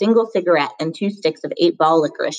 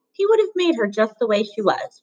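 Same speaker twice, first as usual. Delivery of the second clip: ordinary delivery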